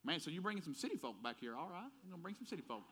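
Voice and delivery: a hint of a rural Southern buddy teasing; country friend. Old school friend teasing about city folk, mild Southern country voice. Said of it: "really country high school friends was like".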